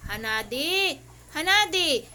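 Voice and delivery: loud call of the dog's name; scolding call. Real, not acted. Owner calling Hanadi out for biting, loud scolding. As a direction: call the name out to stop the dog.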